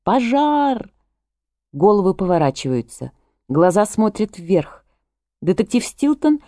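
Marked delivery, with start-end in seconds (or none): 0.0-0.9 a high shouted alarm; Misty raising the alarm from the table; shouting fire. A narrator's reading. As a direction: cry it out over the crowd. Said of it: "Мисти кричит".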